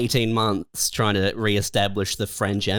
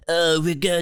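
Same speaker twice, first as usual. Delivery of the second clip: silly put-on French accent, pitched up; mock French accent; 5 semitones higher than usual. Comic mocking French films, exaggerated French accent. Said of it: "Have you listened to that fucking accent".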